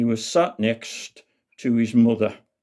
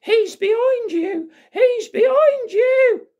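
put-on very high, drawn-out child's yell; excited mock yelling; 4 dB louder than usual. Rupert loving the panto, warning Buttons in high mock yells. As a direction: imitate an excited boy yelling at the stage. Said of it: "he was pointing and yelling".

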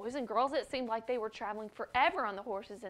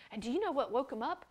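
usual speaking voice

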